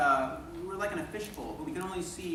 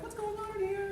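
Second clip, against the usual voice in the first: surprised quote. Retold or imitated. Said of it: "we're like".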